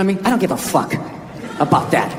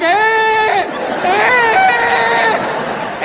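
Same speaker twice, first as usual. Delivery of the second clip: long, high-pitched mock whine; drawn-out high "eh" of a miserable kid; hammed-up childish wail; strained whine; 9 semitones higher than usual. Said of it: "I'm just standing there like".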